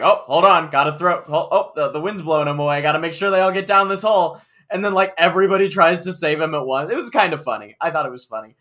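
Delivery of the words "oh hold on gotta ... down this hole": loud, hammed-up bumbling Grunkle; slapstick Grunkle. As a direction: play it as broad slapstick, loudly. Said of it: "slapstick Grunkle just like".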